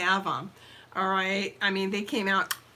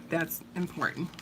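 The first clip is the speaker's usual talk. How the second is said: ordinary talk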